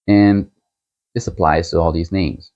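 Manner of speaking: usual speaking voice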